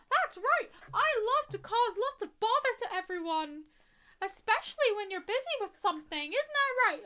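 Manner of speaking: high, squeaky cartoon mouse; Mickey Mouse voice. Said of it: "knockoff Mickey".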